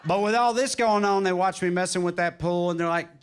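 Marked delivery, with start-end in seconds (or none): none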